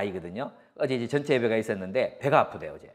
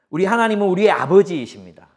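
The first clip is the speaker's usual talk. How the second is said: 하나님은 proclaimed louder and higher; preacher booming the line back to the congregation; loud proclamation; 6 semitones higher, 7 dB louder than usual. Shown as a demonstration. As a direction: announce 하나님은 like a declaration to the church. Statement repeated as proclamation.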